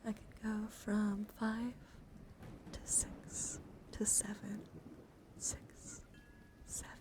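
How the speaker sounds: slow, hushed product showing; slow down and nearly whisper; soft ASMR demo. Acted. Streamer spinning health counters for chat, slow hushed voice.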